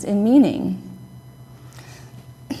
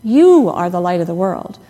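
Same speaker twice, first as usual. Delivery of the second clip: "You" punched loud, the rest trailing; stress on YOU; 3 semitones lower, 5 dB louder than usual. Shown as a demonstration. Preacher recalling a childhood word game, stress on "You". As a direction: hit "You" hard at the start. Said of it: "emphasizing the first word of the sentence".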